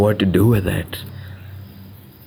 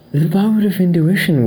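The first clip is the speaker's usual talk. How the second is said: usual speaking voice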